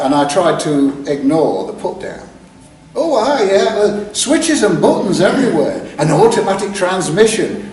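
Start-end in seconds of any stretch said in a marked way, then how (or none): 2.9-7.6 imitating Clarence, higher and louder, showing off the car; chirpy bragging about the automatic; boastful Clarence. Clarence bragging about his posh car, louder and higher.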